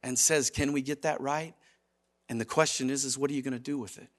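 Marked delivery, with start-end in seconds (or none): 0.5-1.5 soft, low, gentle request; hushed, kindly correction; most gentle. Acted. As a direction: ask it tenderly, voice dropped. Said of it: "in the most gentle of ways".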